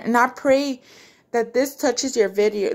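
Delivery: ordinary talk, nothing marked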